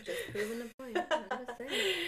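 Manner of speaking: giggling through the words; laughing tease. Real, not acted. Teasing her podcast cohost about being the middle child, laughing.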